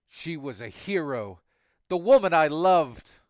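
rising to a loud, high cry; angry. Acted. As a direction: shout the loss out. Cyborg's grief turning into rage.

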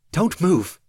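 high, loud pleading; urgent shout. Acted. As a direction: beg him to stay still. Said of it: "Marissa said".

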